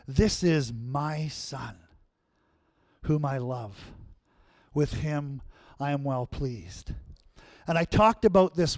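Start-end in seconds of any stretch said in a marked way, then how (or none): 0.1-6.9 voice from heaven. Retold or imitated. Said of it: "a voice from heaven came saying".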